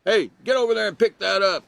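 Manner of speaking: teacher imitating a yelling teacher, mock scolding. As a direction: bark it like a fed-up teacher. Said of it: "instead of yelling at everybody".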